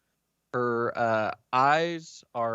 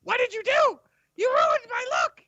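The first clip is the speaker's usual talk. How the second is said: much higher, louder outrage; high outraged NPC; 4 dB louder than usual. Acted. GM voicing a tricked NPC, high and outraged. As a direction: wail at the ruined look. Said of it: "And he's like".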